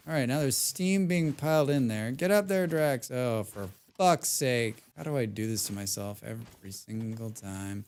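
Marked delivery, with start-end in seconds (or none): none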